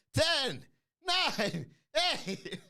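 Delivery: high, loud excited count; playful hyped countdown; mock countdown. Shown as a demonstration. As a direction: shout the countdown like New Year's Eve. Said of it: "That's the countdown we're gonna be doing".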